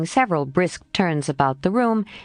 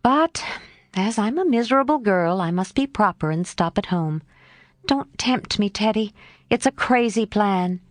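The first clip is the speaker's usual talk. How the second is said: put-on lively, higher young girl; spirited girl voice; 3 semitones higher than usual. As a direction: play a torn, eager tomboy. Jo voiced by narrator.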